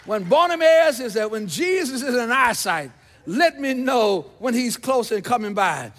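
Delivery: loud, driving sermon storytelling; full-force preaching. Acted. Preacher telling the congregation Jesus is coming, loud and driving.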